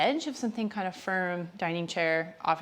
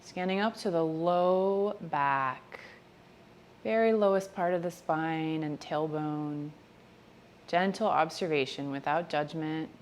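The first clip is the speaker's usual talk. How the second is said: gentle, measured meditation voice, spacious phrasing; guided meditation; 4 dB quieter than usual. Real, not acted. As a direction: ease them through each body part, softly and unhurriedly. Leads a body-scan meditation.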